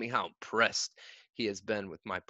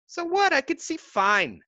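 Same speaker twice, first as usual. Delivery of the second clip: indignant retort; 5 dB louder than usual. A narrator's reading. Boy defies the coach.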